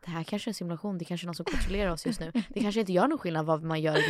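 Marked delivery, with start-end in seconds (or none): 0.0-2.3 mock musing. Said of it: "går runt och bara".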